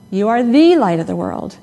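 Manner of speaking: first "the" punched, said as "thee"; stress on THE. Shown as a demonstration. Preacher recalling a childhood word game, stress on first "the". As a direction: lean on the first "the" before "light". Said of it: "and then the third".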